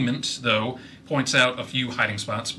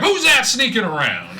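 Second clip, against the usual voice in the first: high, loud yell; 10 semitones higher, 7 dB louder than usual. Acted. Biscuit yells at the sneaking player, high and loud. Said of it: "just yells".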